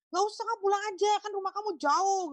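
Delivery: mock-fast insistence to send him home; hurried polite refusal. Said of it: "gitu ya". (menolak dengan cepat, suara lebih tinggi)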